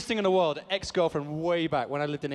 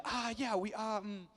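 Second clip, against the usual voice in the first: hesitant, higher and softer; imitating someone unsure how to say it; awkward director; 6 semitones higher, 6 dB quieter, slower than usual. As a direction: fumble for words as a nervous director. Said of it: "he doesn't know how to say it".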